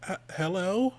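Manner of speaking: a lightly played tongue-tied hello; starstruck stammer. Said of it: "And I'm like".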